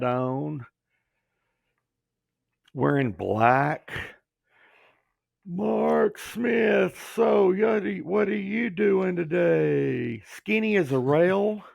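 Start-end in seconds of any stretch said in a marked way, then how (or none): 5.5-10.2 slow drawl. Retold or imitated. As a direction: stretch it out like a boss leaning back in his chair. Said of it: "had this long drawl".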